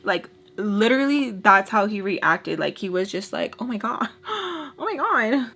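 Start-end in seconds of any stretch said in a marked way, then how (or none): 3.6-5.5 mock-frightened gasping; imitated scared. Imitating his reaction to her screaming, scared. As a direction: act out shock with a high gasp between. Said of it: "Like, scared".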